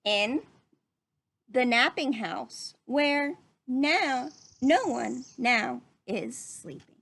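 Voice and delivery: building storybook suspense. Acted. Teacher reading the chaotic ending to the class, slow and dramatic. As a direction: build each line like a chain of mishaps. Dramatic climax of the read-aloud.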